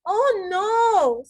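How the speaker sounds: oh no, caught out; dismayed oh no. Acted. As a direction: realise the mistake with a wail. Tom dismayed at eaten cake.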